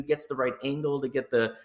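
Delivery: usual speaking voice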